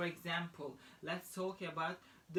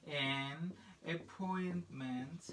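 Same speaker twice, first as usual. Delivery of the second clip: slow, softer word-by-word modelling; slow dictation; 5 dB quieter, much slower than usual. Shown as a demonstration. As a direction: draw the words out softly for learners. Slow dictation of phrase to students.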